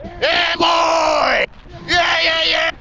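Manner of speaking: high mock-British holler; hammed-up British yelling; screaming British accent. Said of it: "screaming at him in a British accent".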